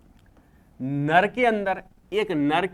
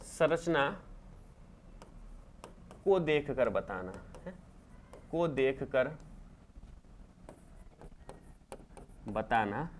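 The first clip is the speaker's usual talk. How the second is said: slow dictation; 3 semitones lower, 10 dB quieter, slower than usual. Shown as a demonstration. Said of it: "dictating the definition to class".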